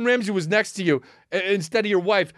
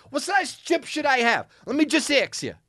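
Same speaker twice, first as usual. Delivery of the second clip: higher, mimicking the owner's challenge; imitating clip owner; 6 semitones higher than usual. Comic repeating owner's line to Gordon Ramsay, mock. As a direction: echo the clip back. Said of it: "repeats clip".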